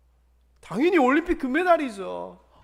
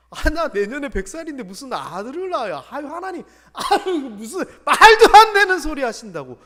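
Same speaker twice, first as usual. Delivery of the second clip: scoffing through a laugh, louder at the end; mocking laugh; 3 semitones higher, 8 dB louder than usual. Abraham scoffing at God's promise, laughing mockingly. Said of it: "웃어요".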